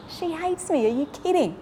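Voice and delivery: high-pitched mock disbelief; incredulous retort. Husband balking at telling his wife of love, incredulous. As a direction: act out his incredulous comeback. Acted disbelieving reply to prayer friend.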